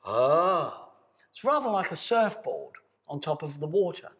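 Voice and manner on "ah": sustained open ah, louder; voiced ah. Shown as a demonstration. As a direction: let the sound ride on the breath. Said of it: "Then sound comes afterwards".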